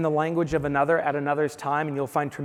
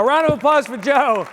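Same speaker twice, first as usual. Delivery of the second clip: higher, louder call to the room; 8 semitones higher, 7 dB louder than usual. Real, not acted. Host to the audience after Joe's story, loud rousing call. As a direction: rouse the crowd to clap.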